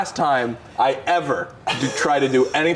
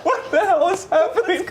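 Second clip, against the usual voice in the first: high-pitched mock bewilderment; bewildered friend. Friend seeing stranger in front seat, high bewildered imitation. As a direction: voice his silent panic, high. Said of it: "his face is like".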